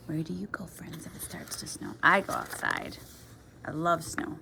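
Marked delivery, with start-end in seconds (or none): 0.1-1.9 soft whisper to the listeners. Shown as a demonstration. Asking the children friends, in a whisper. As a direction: lean in and whisper the question.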